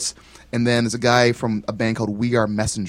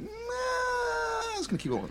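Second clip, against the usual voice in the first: very high, slow falsetto voice; 8 dB quieter, slower than usual. Shown as a demonstration. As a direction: sing it out in falsetto. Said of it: "There's that high voice again".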